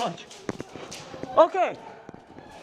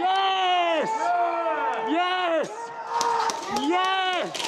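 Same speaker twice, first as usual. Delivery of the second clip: triumphant cheer; 4 semitones higher, much slower than usual. Real, not acted. Winning the round.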